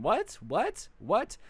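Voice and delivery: repeated questions swooping up high; mock-stunned, high rising query; acted disbelief. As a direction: play baffled shock, each one rising sharply. Friends react to cheating news.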